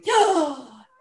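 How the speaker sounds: loud sudden intake of breath; startled gasp; sharp gasp. Shown as a demonstration. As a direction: suck the air in sharply, shocked. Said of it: "as a sudden intake of breath".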